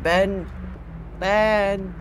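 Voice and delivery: high, loud searching call. Pretend. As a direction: shout the name upward as a question. Calling out for Ben in game.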